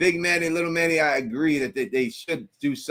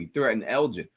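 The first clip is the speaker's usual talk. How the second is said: normal talking voice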